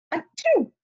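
acted sneeze. Sneezing in story.

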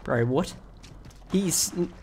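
ordinary delivery

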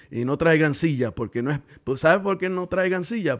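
rising pulpit fervor; fiery preaching. Acted. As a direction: preach it hard to the congregation. Preaching at full force again.

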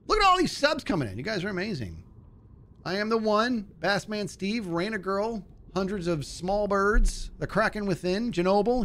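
excited thanks. Real, not acted. Excited shout-out to subscribers.

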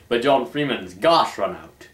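usual speaking voice

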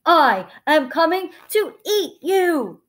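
emphatic drawn-out troll menace; menacing troll. A narrator's reading. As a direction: threaten to gobble up your prey. Troll threatens middle goat.

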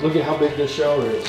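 normal talking voice

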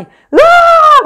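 very high, loud, long held shriek; mock thrilled scream. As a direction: act out a scream of rollercoaster excitement. 흥분의 도가니 비명 연기. (신나서 높고 길게 지르는 비명 흉내)

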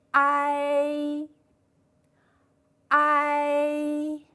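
ai held long, mouth going from wide open to flat; drawn-out pinyin ai. Shown as a demonstration. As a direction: glide smoothly from a into i, steady and even. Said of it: "请同学们跟老师读一读".

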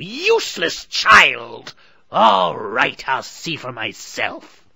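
high, very loud, slow shriek; angry scream. A narrator's reading. Witch screams at Gretel, furious and loud. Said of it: "the witch screamed at the little girl".

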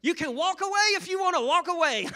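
hammed-up high-pitched yell; loud, retold dare at full stretch; arguing. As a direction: shout it way up high in mock outrage. Acted argument with his wife.

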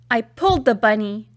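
ordinary delivery

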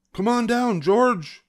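high-pitched call. A narrator's reading. Player calling the monkey down from a pole, calling out. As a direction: call up to someone high above.

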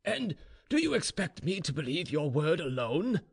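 lightly voiced incredulous general; sceptical general. A narrator's reading. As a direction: challenge him as a doubting general. General doubts the slave's word.

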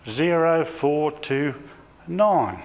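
slow, spaced-out digits; slow number dictation. Retold or imitated. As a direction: read each digit slowly, as if dictating. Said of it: "she said".

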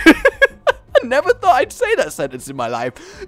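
amused, high and breaking into laughter; cracking up while talking; laughing. Real, not acted. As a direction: say it through real laughter at yourself. Laughs at own absurd sentence.

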